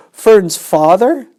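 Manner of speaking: high, loud, slow horrified question; aghast. Acted. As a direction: squeal it in disbelief. Wilbur aghast.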